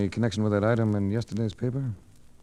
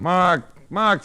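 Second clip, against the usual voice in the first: loud, high calling of the name; annoyed shout; 11 semitones higher, 10 dB louder than usual. Acted. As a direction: yell to make him stop. Frank shouted awake, annoyed.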